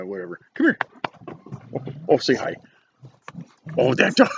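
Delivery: ordinary talk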